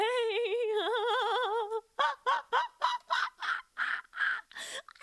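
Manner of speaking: long high delighted cackle; high shrieking laugh. Real, not acted. Losing it over the character, shrieking laugh.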